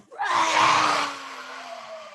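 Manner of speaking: big drawn-out bear roar; polar bear roar. Shown as a demonstration. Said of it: "gonna roar like a polar bear".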